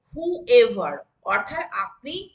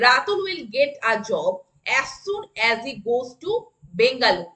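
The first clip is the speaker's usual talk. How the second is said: very slow, word by word; slow classroom dictation; slower than usual. Shown as a demonstration. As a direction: spell it out slowly for the class. Teacher models a sentence.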